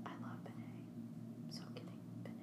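whispered mock repeat; whispering. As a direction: echo it in a teasing whisper. Mocking friend's repeated line.

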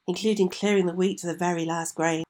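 plain reading voice

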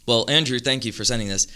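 ordinary delivery

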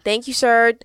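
loud mock southern drawl on the courtesies; southern kid's dutiful replies; childhood southern accent. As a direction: imitate your younger southern-accented self, loudly. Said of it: "I'd be talking like this all the time".

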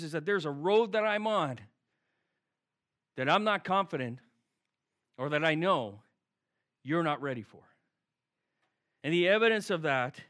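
ordinary delivery

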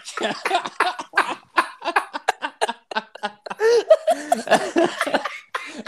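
loud squealing laugh; high-pitched laugh. Real, not acted. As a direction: burst out laughing hard. Big laugh after the joke.

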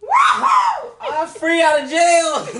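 high laughing shout of joy; excited shout. Real, not acted. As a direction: whoop like you just got out. Celebrating, shouting.

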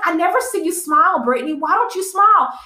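lightly high, chirpy mock boss; high fake-friendly nag; imitating manager. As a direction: parody a pushy manager's prodding. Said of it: "She was like".

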